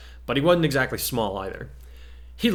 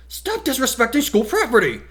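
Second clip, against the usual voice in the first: high, loud scolding of a classmate; a prim student barking out a rule; stern demand; 13 semitones higher, 4 dB louder than usual. Acted. Class rep scolding a slouching classmate, loud and high. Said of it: "the bespectacled teenager demanded".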